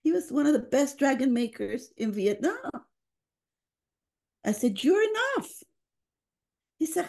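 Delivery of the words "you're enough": reassuring. Retold or imitated. She reassures the father.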